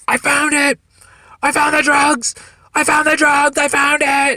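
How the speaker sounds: high, loud, triumphant mock yell; imitating overexcited young cop. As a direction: crow it like an eager rookie. Young cop runs up with his find.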